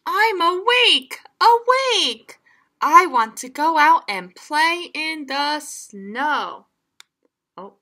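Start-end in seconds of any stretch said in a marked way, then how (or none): 0.1-6.7 high, loud insisting; loud high child's plea; pleading. Acted. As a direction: beg to go play. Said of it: "cried Froggy".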